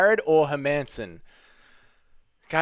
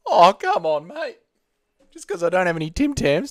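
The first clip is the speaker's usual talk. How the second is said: mock-offended Australian accent, mate pitched up high; fake Aussie protest; 4 semitones higher than usual. Streamer denying he is a fake Aussie, in a mock Australian accent. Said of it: "Fake Aussie confirmed".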